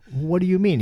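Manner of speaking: high, loud, startled "what do you mean"; patient reacting to a blunt counselor with shock; shocked question. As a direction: caught off guard, demand an answer. Retold shock at diagnosis.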